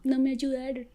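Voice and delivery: high, softened mock crying; imitated tearful voice. Girl from Paris tells her bad news, imitated tearful voice. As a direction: retell it as a plaintive high whimper. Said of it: "llorando, una vez más".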